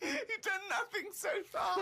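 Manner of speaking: high, near-tearful laughing squeal. Real, not acted. Co-host mocking the diarist's idle holiday, laughing. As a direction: protest through helpless laughter.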